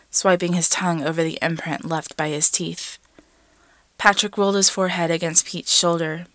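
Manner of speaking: ordinary delivery, nothing marked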